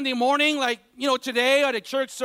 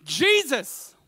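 slower, higher preaching, building force; 4 semitones higher than usual. Acted. Pastor preaching to the congregation, slow and rising.

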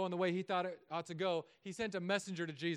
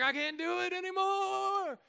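much higher, slow strained whine; straining push-up voice; 13 semitones higher, 8 dB louder, slower than usual. Retold or imitated. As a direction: squeeze it out mid push-up, arms shaking. Dad failing push-up two.

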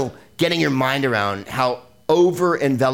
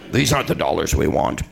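lower, flat stormtrooper line; 6 semitones lower than usual. Pretend. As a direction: deliver it like a wooden stormtrooper. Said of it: "Stormtrooper line readings".

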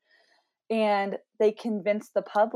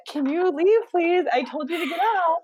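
higher, louder exasperated shoo; shooing brother out; 9 semitones higher, 4 dB louder than usual. Real, not acted. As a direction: get him out of the room now. Shooing brother out mid-podcast.

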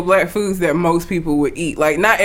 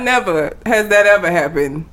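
ordinary talk

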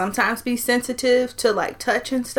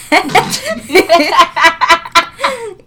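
loud laughter; 8 semitones higher, 9 dB louder than usual. Real, not acted. Laughs at [name].

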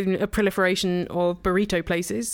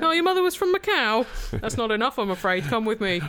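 mock police officer; 4 semitones higher than usual. Mock police arresting a restaurant owner.

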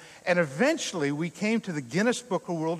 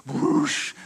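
unvoiced rushing whoosh of the boat; breathy whoosh sound; 5 semitones lower than usual. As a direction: blow out a whoosh like a wave hitting. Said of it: "It goes".

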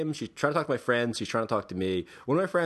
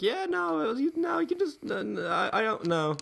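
much higher, stammering brush-off; flustered refusal. Retold or imitated. Man dodging a woman who wants his number, flustered. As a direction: squirm out of it in a high voice.

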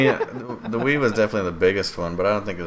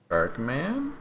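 "Shark Man?" rising at the end; uptalk; 7 semitones higher than usual. Shown as a demonstration. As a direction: lift "Man" up into a question. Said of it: "with an upwards inflection".

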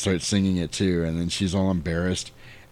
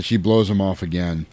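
normal talking voice

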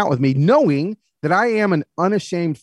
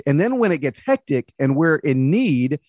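usual speaking voice

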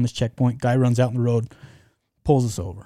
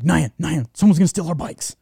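higher, urgent alarm call to a friend; a retold frantic warning, raised and hurried; panicked call; 5 semitones higher, 4 dB louder than usual. Panicked rush back to bikes.

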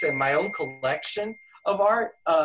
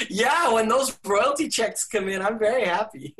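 laughing; 6 semitones higher than usual. Real, not acted. Laughs about royalty checks.

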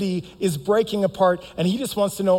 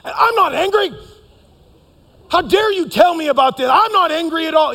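high, loud defensive outburst; mock angry denial; 9 semitones higher, 7 dB louder than usual. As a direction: snap back hotly at a friend's reminder. We start to yell.

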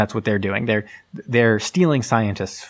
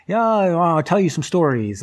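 higher, slow, drawn-out put-on voice; hammed-up raconteur, pitched up; eager storyteller role; 6 semitones higher, slower than usual. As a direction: play an old adventurer offering tales. Voices the fake Quartermain offering tales.

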